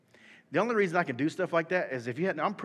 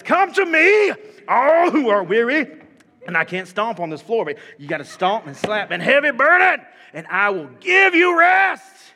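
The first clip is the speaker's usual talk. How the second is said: fiery preaching; 8 semitones higher, 13 dB louder than usual. Acted. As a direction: preach it like an old-time revival. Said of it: "I'm gonna read it like I believe it".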